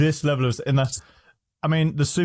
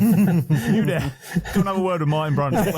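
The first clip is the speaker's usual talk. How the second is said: higher, hurried impression with a laugh in it; Jackie Stewart; 5 semitones higher than usual. Imagining grabbing Floyd Mayweather in Vegas, mock summons. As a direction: collar someone like a bossy old man, laughing. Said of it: "I want Jackie Stewart to go marching over".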